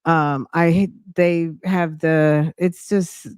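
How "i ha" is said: usual speaking voice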